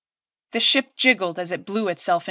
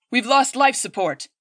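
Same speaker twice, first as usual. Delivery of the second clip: urgent raised alarm call; hollered; 4 semitones higher than usual. A narrator's reading. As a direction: shout the bad news across the bridge. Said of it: "Bertriel hollered".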